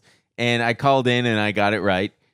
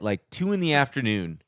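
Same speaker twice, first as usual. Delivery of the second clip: usual speaking voice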